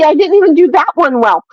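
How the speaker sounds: ordinary delivery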